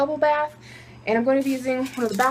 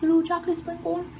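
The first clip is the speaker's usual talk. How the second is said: cooing; 6 semitones higher than usual. Real, not acted. Cooing over bath bomb bits.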